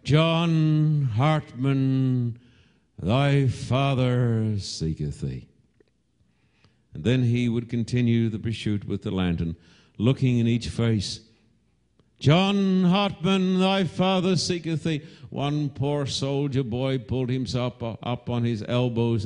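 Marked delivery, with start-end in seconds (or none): 0.0-5.5 long, drawn-out call, easing at the end; searching father's stretched-out cry. Retold or imitated. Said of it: "cup his hands and he'd cried out". 12.2-15.0 sustained, almost sung high cry; calling out. Retold or imitated. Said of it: "he'd cry out".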